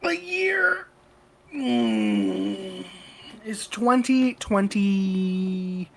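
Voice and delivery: slow, drawn-out movie-trailer opening; mock dramatic announcer. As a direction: announce the year like a film intro.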